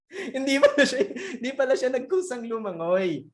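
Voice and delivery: amused, laughing through the words; real chuckling delivery. Real, not acted. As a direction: explain the punchline while cracking up. Laughing at his own punchline.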